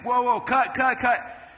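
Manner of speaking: high, insistent stop call; retold director calling cut; urgent interruption. Retold host halting the shoot.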